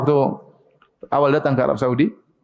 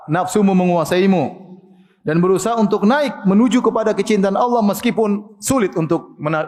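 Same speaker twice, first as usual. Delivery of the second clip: normal talking voice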